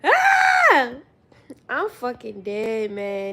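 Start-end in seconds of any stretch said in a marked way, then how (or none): 0.0-0.9 piercing loud shriek; high scream. Real, not acted. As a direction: let out a scream. Screams laughing.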